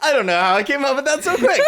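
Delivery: high-pitched, rushed laughing speech; really cracking up at your own improvisation. Real, not acted.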